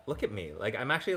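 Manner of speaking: ordinary talk, nothing marked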